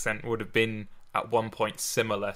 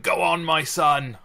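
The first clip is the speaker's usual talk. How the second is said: higher, louder, slow proud father voice; a hammed-up proud dad cheering his son on; mock fatherly send-off; 6 semitones higher, 7 dB louder, slower than usual. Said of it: "a couple of words maybe going".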